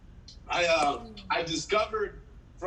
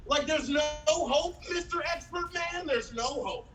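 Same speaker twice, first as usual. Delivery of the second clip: mock despair; 10 semitones higher than usual. Acted despair at the expert.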